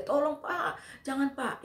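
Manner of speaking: high, slow imitated cry for help; reliving a frightened scream of please sir; retold desperate plea. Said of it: "Saya teriak, saya teriak".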